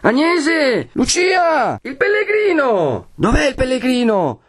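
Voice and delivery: very high, drawn-out searching calls; calling out names. A narrator's reading. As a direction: call for missing people through a house. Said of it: "Si chiama". (richiami acuti e prolungati)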